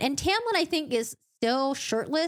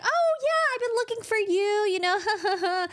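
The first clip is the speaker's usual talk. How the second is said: high, breathy-free flirty purr of a greeting; coy flirt; 11 semitones higher than usual. Retold or imitated. Feyre greeting the stranger: coy high flirt. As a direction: play it coy and far higher. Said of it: "She turns around and she's like".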